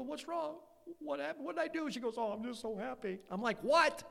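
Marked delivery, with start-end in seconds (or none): 0.0-1.9 high, soft mock-anxious questions; worried husband. Husband to his crying wife, mock worried. 2.2-3.2 softer, higher mock weepy voice; tearful wife. Wife explaining her happy tears, mock tearful. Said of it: "Your wife, she's crying". 3.6-4.0 high, louder mock-incredulous yelp; baffled husband. Husband to his happily crying wife, mock baffled.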